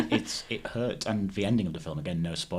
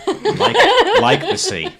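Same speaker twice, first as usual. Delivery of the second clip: much higher and louder, cracking up; laughing; 15 dB louder, slower than usual. Real, not acted. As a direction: laugh through the punchline. Laughing at her own metaphor.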